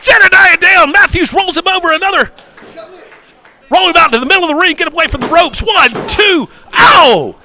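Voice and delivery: fast, excited play-by-play; wrestling commentator. Real, not acted. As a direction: rattle off the moves live.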